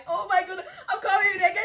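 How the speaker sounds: high, laugh-choked words; real overwhelmed laughter; laughing through tears. Real, not acted. Creator overwhelmed by her award, laughing and near tears. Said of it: "I'm emotional".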